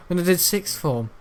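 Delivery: usual speaking voice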